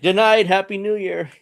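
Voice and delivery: high, playful mimicking sing-out; mock trolling. Host mimicking Maduro trolling his captors, mock-cheery. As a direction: toss it out as a cheeky taunt. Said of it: "So he's kinda trolling. I'm like".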